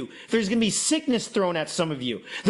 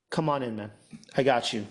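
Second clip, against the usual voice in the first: gentle welcome; 8 semitones lower, faster than usual. Acted. Welcoming the mocking friend home.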